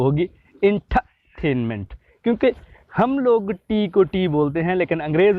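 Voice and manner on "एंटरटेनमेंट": slow एंटरटेनमेंट with an aspirated T and no R; British entertainment. Shown as a demonstration. Teacher showing the aspirated British T in एंटरटेनमेंट. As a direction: breathe out on the T of एंटरटेनमेंट. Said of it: "ठा की sound निकालते हैं".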